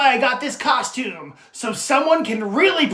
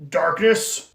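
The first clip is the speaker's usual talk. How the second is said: ordinary talk, nothing marked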